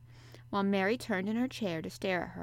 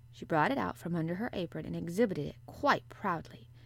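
usual speaking voice

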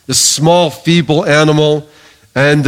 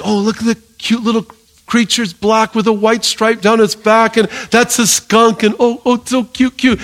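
high, cutesy cooing over a cute animal; naive cooing; 7 semitones higher than usual. Acted. Cooing over a skunk as naive knowledge.

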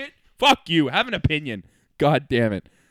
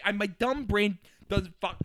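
ordinary delivery

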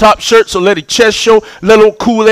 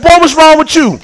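raised, exasperated dad scolding; lightly channel a fed-up comedian father; high, incredulous telling-off; Bernie Mac; 7 semitones higher than usual. Retold or imitated. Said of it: "Y'all know how Bernie Mac be acting".

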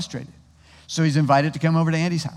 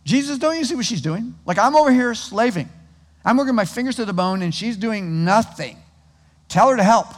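exasperated Martha; 5 semitones higher than usual. Retold or imitated. Preacher voicing Martha complaining to Jesus, exasperated. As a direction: whine to Jesus about her lazy sister. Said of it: "in her total exasperation".

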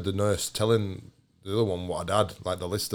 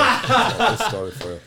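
laughing; 11 semitones higher, 5 dB louder than usual. Real, not acted. Laughing announcement.

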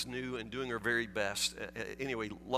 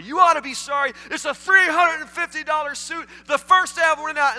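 hammed-up tantrum; 13 semitones higher, 14 dB louder than usual. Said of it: "customer answers manager".

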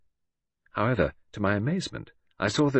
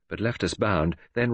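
ordinary delivery

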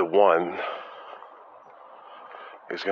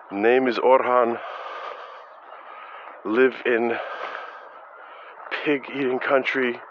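bad Turkish accent; 5 semitones higher, slower than usual. Teacher's students parroting crude lines in a bad Turkish accent. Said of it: "with a bad Turkish accent".

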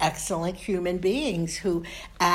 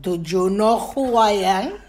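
louder father's challenge, rising at the end; stern father; 5 dB louder than usual. Acted. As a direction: play dad demanding an answer. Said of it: "he would look at me and say".

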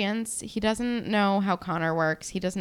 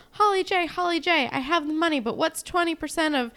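high, rushed and flustered; frantic panicky Jenna; 6 semitones higher than usual. Retold or imitated. Jenna begging Holly J for help with the math, flustered. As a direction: babble it out in a panic.

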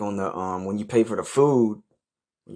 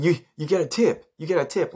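mock nagging; 9 semitones higher than usual. Friend nagging about tipping.

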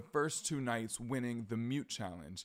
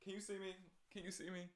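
imitating Kenya; 6 semitones higher, 13 dB quieter, faster than usual. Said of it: "She was like".

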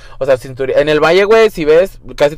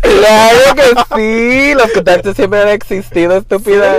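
high, loud, drawn-out sassy comeback; campy diva retort; mock sassy diva; 8 semitones higher, 5 dB louder, slower than usual. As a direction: play a haughty queen scolding her friend.

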